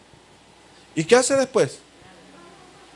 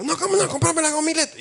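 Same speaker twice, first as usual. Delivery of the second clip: put-on much higher, whining protest like a spoiled kid; child's tantrum; 10 semitones higher than usual. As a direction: throw a little tantrum for the lollipop. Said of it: "haciendo el berrinche el bicho".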